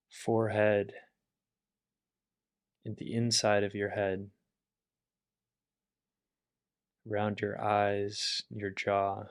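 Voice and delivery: calm, level meditation voice with long pauses; guided meditation. Acted. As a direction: guide them gently through relaxing the body. Leads a body relaxation.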